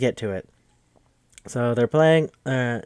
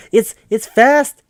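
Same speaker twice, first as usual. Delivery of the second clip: excited; 10 semitones higher, 10 dB louder, slower than usual. Real, not acted. Gushing about the movie.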